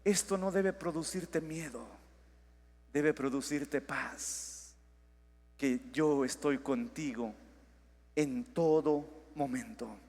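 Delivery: soft, low, reassuring, slowing down; gentle voice of God. Pretend. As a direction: speak God's comfort tenderly. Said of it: "Dios te dice".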